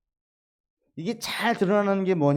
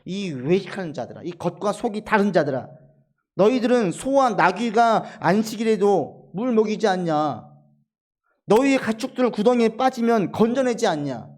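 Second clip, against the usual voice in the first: higher and louder, a preacher voicing righteous anger; Jesus' angry rebuke; 5 semitones higher, 6 dB louder than usual. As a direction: thunder at the hypocrites. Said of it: "예수님께서 화가 나시는 거예요".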